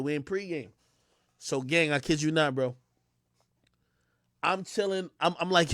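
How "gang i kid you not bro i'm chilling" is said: ordinary talk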